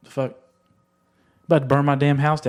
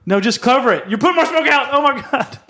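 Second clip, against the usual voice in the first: grenade warning yell; 11 semitones higher, 4 dB louder than usual. Real, not acted. Smoke alarm panic in studio.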